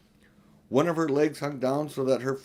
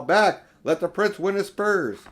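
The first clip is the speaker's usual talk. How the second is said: loud, higher lion's command; commanding shout; 7 semitones higher, 7 dB louder than usual. Acted. Aslan orders the creatures to hold back, shouting. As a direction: bellow it to hold the crowd back.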